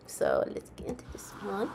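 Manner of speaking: soft unvoiced whisper to viewers; hushed aside. Real, not acted. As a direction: whisper it conspiratorially. Whispered before playing video.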